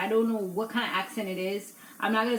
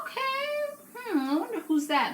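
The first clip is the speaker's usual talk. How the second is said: mock curious wondering; 14 semitones higher than usual. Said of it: "at first, I'm like".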